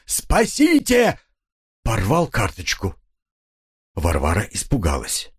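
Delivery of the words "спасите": high, long-drawn wail for help; crying out. A narrator's reading. Husband grabs the bread card, crying out for rescue. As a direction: stretch the cry out high. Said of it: "с криком".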